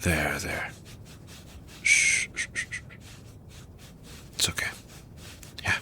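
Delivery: soft shushing comfort; calm them down gently; soothing hush. Acted. Comforting the crying listener.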